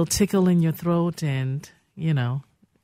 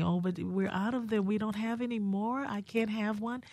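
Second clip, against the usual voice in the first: imitating crying children; 5 semitones higher, 9 dB quieter than usual. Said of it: "crying and asking".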